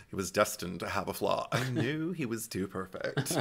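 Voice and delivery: soft, offhand musing; oblivious Tatum. Retold or imitated. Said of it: "Tatum's not reading the room at all".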